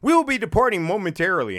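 high, loud, quick announcer imitation; mock automated announcement; recorded train announcement. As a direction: chirp it out like a canned departure notice. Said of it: "And the thing will go".

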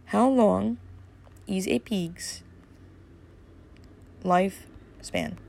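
slow, spaced-out words with long pauses; slow dictation. Real, not acted. Podcaster looking up a pig's lifespan, slowly sounding out the query. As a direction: say it piece by piece, haltingly.

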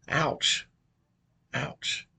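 breathy whispered wince; whispered ouch. Pretend. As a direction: whisper it like a sting. Mock wince after doctor joke.

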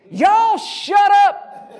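high-pitched, drawn-out holler; loud, slow, high shout of exasperation; comic shout. Acted. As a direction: yell to silence a whole crowd. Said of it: "of saying what he said".

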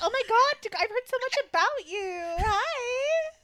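high, loud mock fangirl gush; gushing greeting. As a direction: squeal hello as if starstruck. Imagines Jessamine gushing at meeting.

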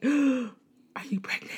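gasping, high, slow mock alarm; shocked gasp. The teacher fears the crying student is pregnant, shocked. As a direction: gasp and ask in horror. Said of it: "it was like".

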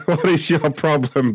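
asking through laughter; can't keep a straight face; laughing while taunting the caller. Real, not acted. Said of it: "I'm sorry".

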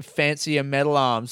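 ordinary talk

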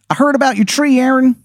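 very high, badly faked Maryland accent; a hammed-up Maryland accent that can't land; terrible Maryland accent. Game master voicing Shithead Steve to Aaron, awful Maryland accent. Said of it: "the worst Maryland accent you have ever heard".

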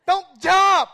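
high, very loud frantic shout; screaming. Retold or imitated. Lifeguard screaming at toddlers in shallow water. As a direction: scream it at little kids. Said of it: "and he'll scream".

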